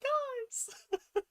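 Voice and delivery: very high, tearful squeal; tearfully moved. Real, not acted. Fan moved by the show, tearful high gushing. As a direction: gush in a high voice, close to crying.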